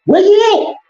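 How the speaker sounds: mocking high-pitched call; high taunting call. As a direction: call out high like hunting for a kid. Repeated taunt.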